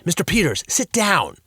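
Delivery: loud, sharp command; stern order. A narrator's reading. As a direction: order him to sit. Principal orders janitor.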